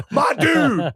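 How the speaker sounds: high, loud cry through laughter; laughing shout. Retold or imitated. Dad cracking up at his son's fart, laughing shout. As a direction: holler it while cracking up.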